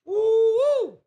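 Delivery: very high, loud joyful whoop; celebratory whoop. Retold or imitated. Streamer celebrates the power returning, loud high whoop. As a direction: cheer like the lights just came on.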